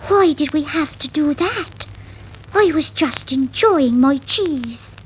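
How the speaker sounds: a touch of whiny protest; Miranda's voice. Acted.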